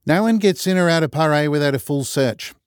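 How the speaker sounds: put-on broad kiwi accent, firm; stern kiwi prison guard; New Zealand accent. A narrator's reading.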